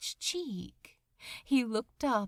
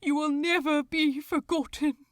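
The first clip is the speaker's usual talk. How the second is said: higher and louder, voice full of tears; emotional cry; 6 semitones higher, 7 dB louder than usual. A narrator's reading. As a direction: call it out as a final promise. Angel's last promise to dying man.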